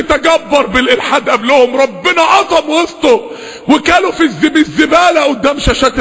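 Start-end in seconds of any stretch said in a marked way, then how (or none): none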